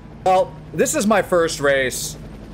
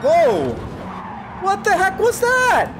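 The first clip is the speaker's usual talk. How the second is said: high, drawn-out shocked exclamation; what just happened, stretched high; startled whoa; slower than usual. Real, not acted. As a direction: caught off guard by a hit. Crashed in race.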